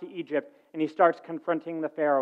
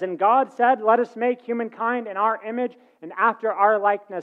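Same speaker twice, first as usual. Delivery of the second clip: a higher, lifted voice reading scripture; 6 semitones higher, 4 dB louder than usual. Acted. Preacher reading Genesis to the congregation, lifted voice. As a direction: read the verse out, raised and solemn.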